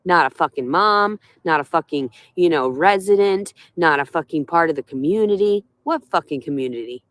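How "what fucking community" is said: low bitter mutter. Real, not acted.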